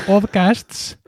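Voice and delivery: podcasts over-pronounced in English, with a laugh; laughing, exaggerated English podcasts; emphatic English pronunciation. Real, not acted. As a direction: hit every consonant of podcasts. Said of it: "énfasis que pones en pronunciar podcast".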